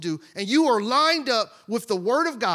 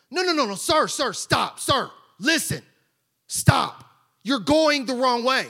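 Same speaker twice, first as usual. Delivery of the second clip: louder, higher pleading stop; urgent shout; 4 semitones higher, 5 dB louder than usual. Acted. Bystander shouting at the man to stop, urgent. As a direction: shout to stop him going back.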